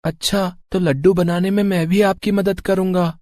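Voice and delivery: put-on high young boy's voice, sulky; a small boy insisting he'll have fun; child voice. Little Bunty talking to his parents, high child voice.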